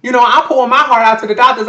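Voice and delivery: usual speaking voice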